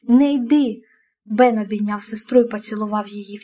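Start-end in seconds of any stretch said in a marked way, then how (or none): 0.0-0.8 urgent, understated plea; a touch of begging in it; pleading. A narrator's reading. Ієн begging Бен not to go, pleading. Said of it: "заблагав Ієн".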